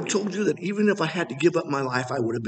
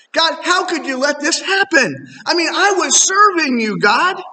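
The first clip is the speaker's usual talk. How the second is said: indignant complaint; 9 semitones higher, 6 dB louder than usual. Retold or imitated. Preacher retells complaining to God.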